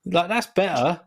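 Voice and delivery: ordinary delivery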